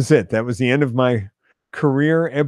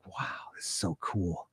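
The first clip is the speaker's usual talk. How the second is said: awed whisper; 4 semitones lower, 16 dB quieter than usual. Retold or imitated. Kid awed at free lunch.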